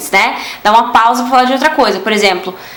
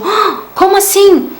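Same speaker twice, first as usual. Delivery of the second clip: higher pitched "ah, como assim?"; mock surprise; 8 semitones higher than usual. Shown as a demonstration. Teacher illustrates the exclamation, mock surprise. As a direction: act out a light surprised disbelief. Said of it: "é uma exclamação de tipo".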